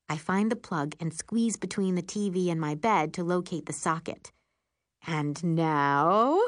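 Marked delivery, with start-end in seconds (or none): 5.1-6.4 drawn-out announcer build-up, rising at the end; radio announcer voice. A narrator's reading. Narrator introduces the TV test, mock radio announcer. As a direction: hold the suspense like a radio host. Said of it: "in my radio announcer voice".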